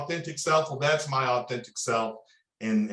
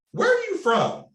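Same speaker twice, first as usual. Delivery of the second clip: imitated girl in a much higher pitch; retold girl; 4 dB louder than usual. Girl at a house party questioning him, high mimicked voice. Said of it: "And a girl's like".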